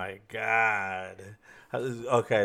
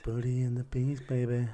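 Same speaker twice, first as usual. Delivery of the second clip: whisper. Acted. Intro title whispered again.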